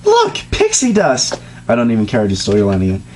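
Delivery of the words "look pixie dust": high, slow squeal of delight; excited Pinkie Pie. A narrator's reading. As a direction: squeal it like a giddy pony finding treasure. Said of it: "Pinkie exclaimed".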